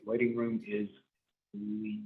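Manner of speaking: ordinary delivery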